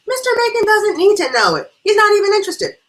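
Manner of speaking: high, very loud shutting-down; exasperated outburst. Acted. Hostess silencing her gossiping friend, exasperated outburst. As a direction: cut the gossip off at full volume.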